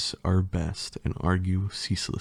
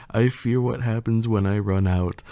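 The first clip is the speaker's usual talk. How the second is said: plain reading voice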